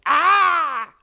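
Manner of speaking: high, loud cry of fright; frightened scream. Acted. Other animals hear the lion's roar, screaming. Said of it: "were like".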